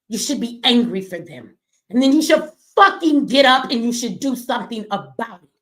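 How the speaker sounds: ordinary talk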